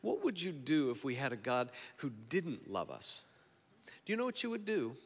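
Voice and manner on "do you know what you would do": higher, lightly British-tinged question; British accent. Real, not acted. Preacher slipping into British as he questions the congregation, British accent. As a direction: slip into a British lilt, pitched up. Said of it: "kinda got British on you there".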